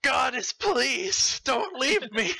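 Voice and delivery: desperate plea. Acted. Impa pleads, higher.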